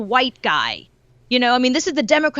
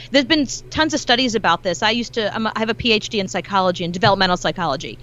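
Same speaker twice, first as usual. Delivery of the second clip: usual speaking voice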